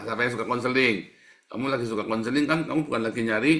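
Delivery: normal talking voice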